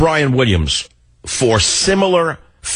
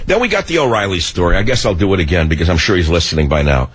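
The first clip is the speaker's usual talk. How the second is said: ordinary talk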